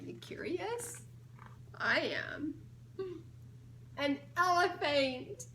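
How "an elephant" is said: laughing. Real, not acted. Reads answer while laughing.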